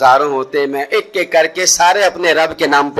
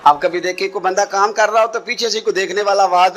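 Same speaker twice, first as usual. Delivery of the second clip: usual speaking voice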